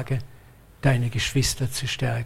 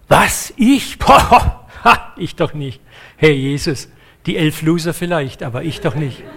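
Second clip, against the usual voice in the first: ungläubig-prahlerisch; 5 dB louder than usual. Acted. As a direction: blurt out cocky disbelief, then settle into dismissive bragging. Acts Petrus boasting in disbelief. (empört und großspurig)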